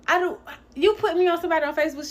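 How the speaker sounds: can't believe what she's hearing; rattled off in outraged shock; incredulous disbelief. Retold or imitated. Confronting client about Facebook scammer.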